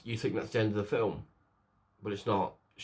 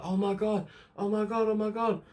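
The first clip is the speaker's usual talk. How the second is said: mild mock scream; 12 semitones higher than usual. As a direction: act out horrified cries in a high voice. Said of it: "Starts screaming".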